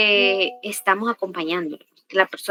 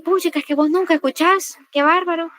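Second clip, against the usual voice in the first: much higher-pitched accusing complaint; exasperated reproach; 7 semitones higher than usual. Shown as a demonstration. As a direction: act out a fed-up complaint without shouting. Acts out the unassertive complaint. (reproche exasperado, en tono agudo)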